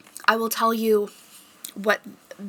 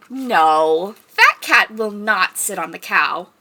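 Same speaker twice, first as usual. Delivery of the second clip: louder, emphatic storybook no; reading to children; 8 dB louder, slower than usual. Pretend. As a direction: tell the kids firmly but playfully. Reading picture book aloud.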